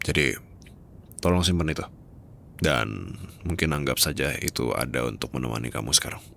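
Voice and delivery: ordinary talk, nothing marked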